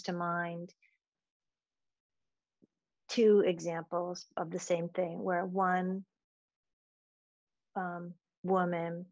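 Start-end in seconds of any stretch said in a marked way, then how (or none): none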